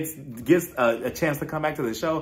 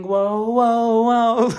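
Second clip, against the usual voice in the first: loud, higher whoas breaking into laughter; excited whoa; 9 semitones higher, 5 dB louder, much slower than usual. Real, not acted. Host reacts to the bench-warmer insult, loud laughing whoa. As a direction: hold them back, laughing and thrilled. Shocked reaction to reunion fight.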